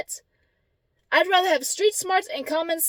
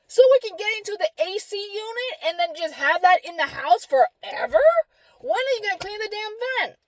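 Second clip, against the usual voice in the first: high, exasperated sarcastic questioning; worked-up retold scolding; indignant comeback; 9 semitones higher than usual. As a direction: challenge them with indignant disbelief.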